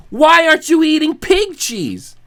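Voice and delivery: ordinary delivery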